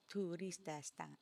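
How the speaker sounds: Turista-san in slow Japanese syllables; Japanese-style Tristan. Shown as a demonstration. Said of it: "how would you say Tristan".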